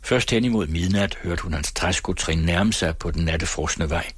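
plain reading voice

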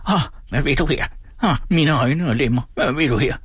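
put-on high wailing old man, last word wrenched from the throat; repeated greedy whine. A narrator's reading. Old man repeats himself at the silent boy, high whine. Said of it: "skruede han ud af sig med en sådan kraft".

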